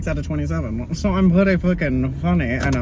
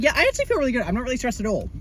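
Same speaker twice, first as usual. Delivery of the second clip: higher, airy mock of a calm girl; mock breezy classmate; 8 semitones higher, 3 dB quieter, much faster than usual. Mocking the classmate who felt fine, lightly higher. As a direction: play her as untroubled. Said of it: "she's like".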